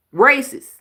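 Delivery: loud, high single word; emphatic verdict. Real, not acted. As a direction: land the word like a judgement.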